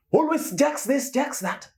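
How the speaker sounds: high-pitched sing-out of complaint; fed-up, high mock nag; exasperated mocking. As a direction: mimic the nagging, voice way up. Mocks the ex's constant demands.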